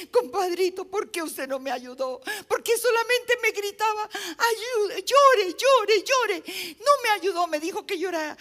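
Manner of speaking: high, loud mock complaint; aggrieved reproach. Rescued man demanding why compadre didn't help, aggrieved complaint. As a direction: protest indignantly, rising into yells.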